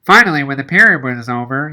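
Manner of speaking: plain reading voice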